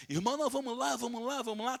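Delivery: high, eager coaxing voice; urging brothers. Retold or imitated. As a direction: pull a reluctant friend along, pitched high. Brothers urging him to come out.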